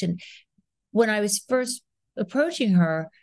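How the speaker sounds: normal talking voice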